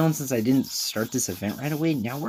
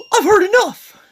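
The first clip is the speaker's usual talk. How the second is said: very high, loud princely outburst; pompous royal shout, pitched way up; [name]; 11 dB louder than usual. Acted. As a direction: cut in as an offended, pompous prince. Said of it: "a, a, a pompous prince".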